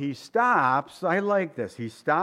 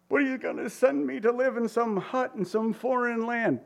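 mock whining objection; 8 semitones higher than usual. Mock objection, much higher.

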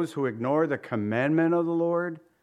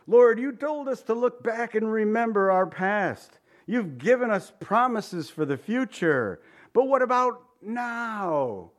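raised, urgent plea to God; pleading people; 7 semitones higher, 4 dB louder than usual. Pretend. Preacher voicing the people pleading, a bit raised. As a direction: press God with rising impatience. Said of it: "the response of the people is".

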